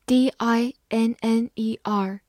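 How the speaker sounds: ordinary talk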